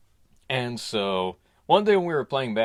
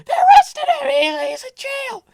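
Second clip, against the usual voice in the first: very high shrieking wail; mother shrieking the shocking news; hysterical mom; 10 dB louder than usual. Retold or imitated. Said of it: "and I was like".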